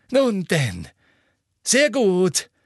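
high, loud, gloating approval. A narrator's reading. Minister gloats over the confession. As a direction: relish the victory. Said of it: "strahlte vor Häme".

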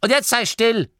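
high, loud granny snap; automatic shush. A narrator's reading. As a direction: cut him off out of habit. Oma Morky shushes Turret.

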